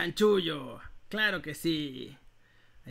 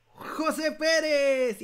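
high, slow winner reveal; booming prize announcer; 7 semitones higher, 4 dB louder, much slower than usual. Acted. Anuncia ganador del sorteo. (anunciador de premios con voz potente)

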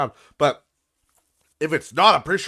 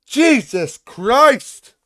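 slow, drawn-out exasperated groan; 9 semitones higher, 9 dB louder, much slower than usual. Real, not acted. Fed up with the tax office, slow exasperation. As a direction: let it drag out in disbelief.

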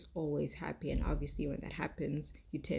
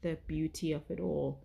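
normal talking voice

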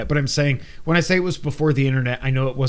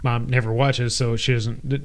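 ordinary delivery

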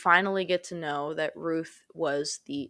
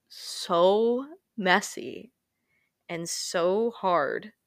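slow emphasis; much slower than usual. Real, not acted. Emotional point about life.

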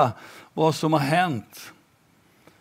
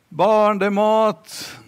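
put-on grandma calling the kids in, higher and louder; 8 semitones higher, 4 dB louder, slower than usual. Grandma calls the children in for dinner, loud call. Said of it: "det är mormor som ropar".

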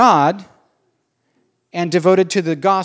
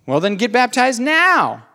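mock Paul; 6 semitones higher than usual. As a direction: play Paul stating the obvious, then a snappy "Duh". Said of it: "says Paul".

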